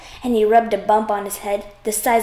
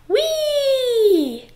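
whee; 13 semitones higher than usual. Acted. Froggy flies into air.

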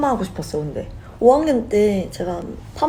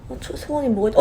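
quick, panicky, tumbling words; flustered rush; 4 semitones higher, faster than usual. Retold or imitated. Rushing her wish as the candle melts, flustered. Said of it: "초를 보면 너무 막 완전 녹고 있어서".

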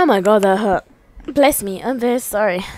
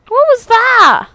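much higher, louder slow squawk; startled shock; 12 dB louder, slower than usual. Real, not acted. Player startled by a noise in the game, a high shocked cry. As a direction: jump at the strange noise.

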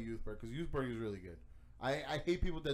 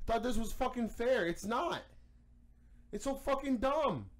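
pitched way up and furious; heated rant; 8 semitones higher than usual. Real, not acted. Ranting at Bushiroad's developers, furious and high-pitched. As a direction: let the outrage boil over at the card designers. Ranting about an unfair card.

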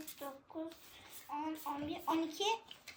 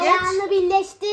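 loud command; 16 dB louder, slower than usual. Real, not acted. Telling someone to hold still.

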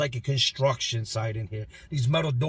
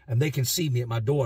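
normal talking voice

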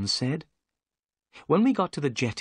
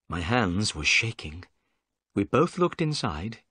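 plain reading voice

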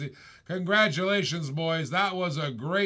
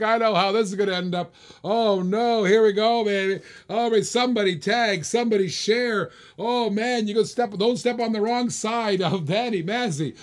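ordinary talk, nothing marked

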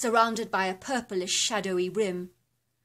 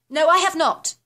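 sharp denial; 8 semitones higher, 8 dB louder than usual. Acted. Old woman denies having the cat.

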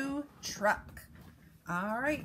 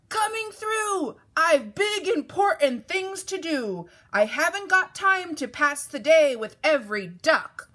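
loud, raised, self-important truck voice; yelling dump truck; 5 semitones higher, 7 dB louder than usual. A narrator's reading. Dump truck with important things to do, yelling. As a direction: call it out forcefully, too busy to stop. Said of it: "yelled a dump truck".

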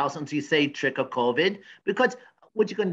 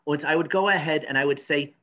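ordinary delivery

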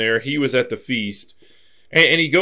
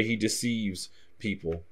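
quiet solemn; 4 semitones lower, 12 dB quieter than usual. Retold or imitated. Quiet dramatic restatement.

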